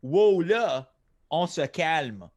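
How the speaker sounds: punched "Whoa, là", then "on se calme"; French phrase demo. Shown as a demonstration. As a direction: model "on se calme" with French vowels, falling at the end. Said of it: "say it in French, you could say".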